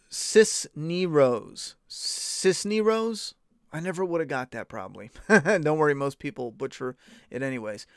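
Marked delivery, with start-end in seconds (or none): 0.1-1.7 Cisneros slowly, as sis-nee-rose; careful, slow reading of Cisneros; pronunciation demo. Shown as a demonstration. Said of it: "It's pronounced". 1.9-3.3 Cisneros slow and high, as sis-nee-rose; questioning pronunciation. Shown as a demonstration. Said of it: "It's pronounced".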